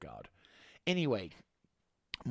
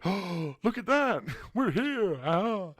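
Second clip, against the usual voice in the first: mock-excited cry, higher and louder, laughing; 7 semitones higher, 5 dB louder, slower than usual. Vlogger pretending he's caught up with the story, mock-excited. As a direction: gleefully announce you've arrived. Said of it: "I'll be like".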